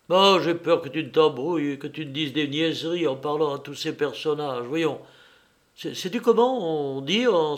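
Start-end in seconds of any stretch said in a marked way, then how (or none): none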